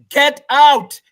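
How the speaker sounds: loud, sharp order to get out; shouted command. Retold or imitated. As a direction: throw him out with your voice. Ambassador thrown out, acted command.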